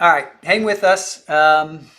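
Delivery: loud, grabbing attention; calling out. Real, not acted. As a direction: raise your voice to hold the audience. Calls out to viewers before clip.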